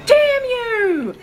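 loud high outburst of fright; real startled shout; startled yell. Real, not acted. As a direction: yell at the jump scare. Startled in horror game.

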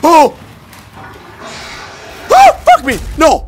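gritos asustados de golpe; grito de susto. Real, not acted. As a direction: gritar del susto, fuerte y agudo. Susto en el juego. (grito de miedo)

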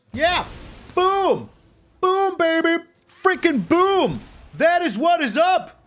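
high, loud victory shouts; triumphant yelling. Real, not acted. Player wins the match with a lethal trigger, triumphant shouting. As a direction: roar it out, you just won.

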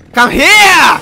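loud, high call; challenging shout. Real, not acted. Player calls the monster over with chainsaw, challenging shout.

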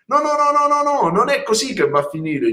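a fussy little pig waving the dragon off. Acted. Second little pig turning the dragon away, high-pitched. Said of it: "gli risponde il secondo porcellino".

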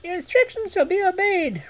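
very high squeaky rat voice; eager, relieved little rodent. Acted. Rat replies.

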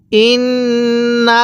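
ইন্নাল্লাযীনা intoned very slowly, the nasal held with ghunnah; teacher modelling ইন্নাল্লাযীনা in tajweed; slow Quranic recitation. Shown as a demonstration. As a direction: chant ইন্নাল্লাযীনা slowly, holding the double n. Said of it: "আরেকবার পড়বেন".

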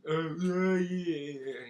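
put-on high, loud baby babble; baby babbling. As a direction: babble like an infant. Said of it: "making all these".

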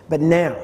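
"now" leaned on and falling; stress on NOW. Shown as a demonstration. Teacher showing the class different emphasis, stress on "now". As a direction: land firmly on "now". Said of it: "putting emphasis on different words".

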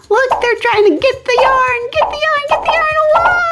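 high-pitched mock urgency; rising, squeaky play voice; playful excitement. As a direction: squeal along with the chasing kittens. Excited play with toy cats.

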